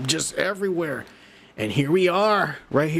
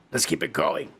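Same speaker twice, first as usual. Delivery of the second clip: deep segment intro; 6 semitones lower than usual. Acted. Announcing next segment.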